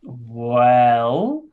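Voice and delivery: very slow, deadpan gnome; speaking slowly. Acted. Gnome mocking the goblin's question by speaking very slowly. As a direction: drag each word out sarcastically. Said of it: "I speak slowly".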